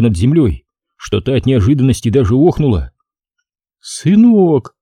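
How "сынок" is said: high cry of a startled mother; overcome exhale. A narrator's reading. Mother suddenly lifted by her returning son, overcome exclamation. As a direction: breathe it out in mild shock, pitched high. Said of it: "выдохнула Зинаида Максимовна".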